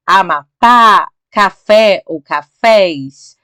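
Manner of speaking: "Amapá, café ou cafés" with the final syllables loud and drawn out; stress on last syllable. Shown as a demonstration. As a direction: lean slowly and loudly on the endings of "Amapá, café ou cafés". Teacher stresses final syllables of examples.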